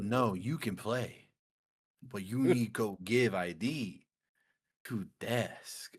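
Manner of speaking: slow, drawn-out imitation; stilted, dragging instruction; odd-talking bar guy. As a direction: stretch each word like the strange bar guy. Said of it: "why he's talking like this".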